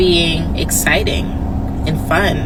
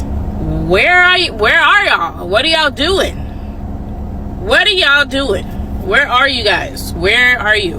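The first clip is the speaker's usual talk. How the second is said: mock calling out; 6 semitones higher than usual. Woman calling out for men who never show up. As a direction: shout it out like searching for them.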